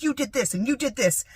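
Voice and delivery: lightly acted angry finger-pointing; angry accusing. Rejecting the idea of blaming dad, mock angry accusing. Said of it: "I'm not gonna be angry".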